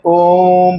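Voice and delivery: ओम with the ओ stretched extra long; drawn-out ओम, as when chanted; prolonged vowel. Shown as a demonstration. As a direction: hold the vowel of ओम well beyond a long vowel. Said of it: "उसको हम लोग कहते हैं प्लुत".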